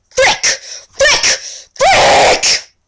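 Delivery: furious shrieks. Acted. Girl shrieking as her books fall.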